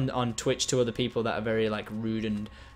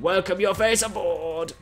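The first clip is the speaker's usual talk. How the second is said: slow, drawn-out, higher-pitched catchphrase; showy welcome; 5 semitones higher, slower than usual. Real, not acted. Streamer welcoming new subscribers, showy drawn-out catchphrase. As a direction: stretch it out like a grand welcome.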